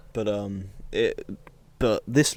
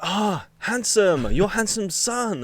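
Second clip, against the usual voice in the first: slow, higher, lightly put-on Chinese accent; slight Chinese accent; 10 semitones higher, 5 dB louder, much slower than usual. Petrol station guy greeting the son, slight Chinese accent. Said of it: "Why have you done a slight Chinese accent".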